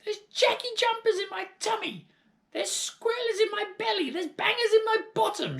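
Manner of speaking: put-on high, loud, crying old-woman complaint; wailing grandmother. A narrator's reading. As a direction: moan about her belly in agony.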